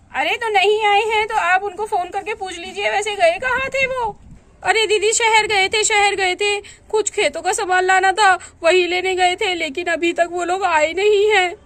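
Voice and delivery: ordinary talk, nothing marked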